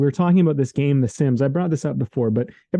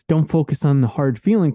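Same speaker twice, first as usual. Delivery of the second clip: ordinary delivery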